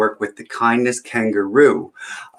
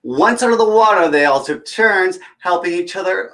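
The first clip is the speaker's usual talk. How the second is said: put-on bright, higher read-aloud voice for kids; storybook reading; 9 semitones higher, 5 dB louder than usual. Storyteller reading a picture book to children, lively. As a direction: read it like story time at the library.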